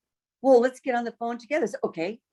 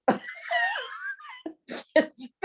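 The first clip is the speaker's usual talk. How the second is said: high laugh; 3 dB quieter than usual. Real, not acted. Big laugh after the story.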